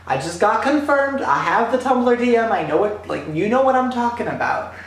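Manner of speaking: high, pleading protest; reenacted exasperated pleading; urgent insistence. Pleading with will call staff.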